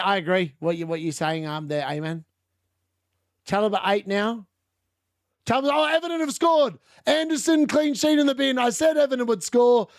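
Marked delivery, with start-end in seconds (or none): 5.5-9.9 excited outburst. Real, not acted. Goal just scored live on stream.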